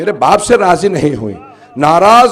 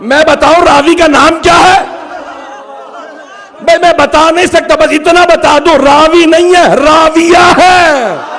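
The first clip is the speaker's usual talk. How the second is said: high, loud declamation building to a drawn-out shout; full-force preaching; 8 semitones higher, 5 dB louder, slower than usual. Acted. Preacher hammers home the narrator's identity to the congregation, full-force shout. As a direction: proclaim it at the top of your voice.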